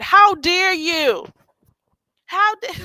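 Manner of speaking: high, loud, slow "How dare you?"; mock outrage. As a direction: ham up the indignation.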